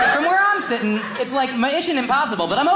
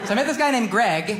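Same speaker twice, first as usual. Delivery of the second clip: ordinary talk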